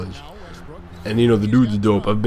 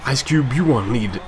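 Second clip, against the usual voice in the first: normal talking voice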